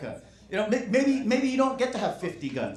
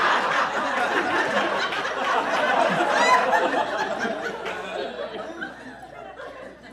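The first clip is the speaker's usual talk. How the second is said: high laugh. Real, not acted. Crowd roars after the bit.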